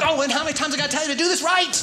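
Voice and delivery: fast exasperated dad scold; impatient coach. Retold or imitated. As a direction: snap at your own kid. Snapping at own son.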